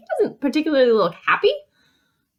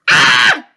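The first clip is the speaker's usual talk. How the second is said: loud harsh angry shout; short demo yell of anger; angry sound; 9 semitones lower, 12 dB louder than usual. Shown as a demonstration. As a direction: make a big angry "ah". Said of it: "can you make an angry sound?".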